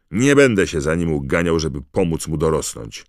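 put-on angry teenage shout; loud shout. A narrator's reading. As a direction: yell it so the owls fly off. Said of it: "krzyknął Harry tak głośno".

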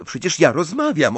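high, loud protest; indignant. A narrator's reading. Ginny protesting to her mother, indignant. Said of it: "oburzyła się Ginny".

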